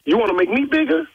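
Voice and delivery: high, furious, incredulous tirade; furious rant. Real, not acted. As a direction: rant at him in outrage. Furious rant at prank caller.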